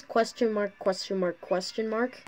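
each "question mark" lifted like a question; a playful questioning lilt on "question mark". Shown as a demonstration. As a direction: ask "question mark" three times over. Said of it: "question mark with the question tone".

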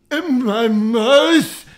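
loud, drawn-out yell on MOUTH; high, stretched comic wail of dread; mock outcry. Food challenger dreading the next bite, loud mock outcry. Mock cry echoing the previous words.

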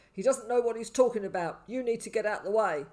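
loud, firm instruction; gruff paramedic. A narrator's reading. Burly paramedic tells boy to move, gruff. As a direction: order him aside gruffly. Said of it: "who was gruff".

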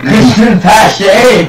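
drawn-out shout. Acted. Playful shouted name.

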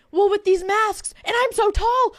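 high, drawn-out sheepish excuse; mock the flustered colleague, high and slow; high, slow hammed-up excuse.

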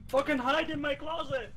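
urgent yell. Real, not acted. Player tells teammate to hide during a hunt, yelling. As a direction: call it out urgently over the danger.

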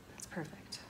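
soft whispered aside; whispering. Real, not acted. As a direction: breathe it out under your breath. Quiet aside to herself.

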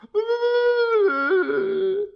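high, loud cartoon wail; mock crying. Dirty Birdie sad in his chair, mock crying wail. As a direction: bawl on a long high note. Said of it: "he starts to cry".

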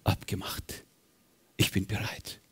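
soft whispered resolve; Jesus whispering his consent. Acted. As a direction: whisper it, looking him in the eye. Jesus agrees, eyes locked.

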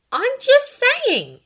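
high, loud, drawn-out protest; insistent. A narrator's reading. Hermione defending her point, insistent. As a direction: stretch it out insistently. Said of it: "she insisted".

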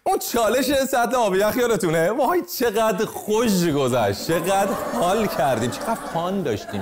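high-pitched, drawn-out nostalgic raving; exaggerated excited reminiscing, stretched words; mock gushing. Mock-nostalgic gushing over old challenge.

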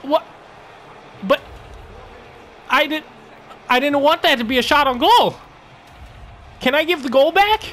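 high, loud, can't believe it went in; stunned disbelief. Real, not acted. Gamer shocked his rim pass became a goal, stunned disbelief.

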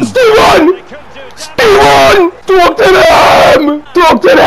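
triumphant shouts. Real, not acted. Gamer celebrating Steven's goal, triumphant shouts. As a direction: scream the scorer's name in joy.

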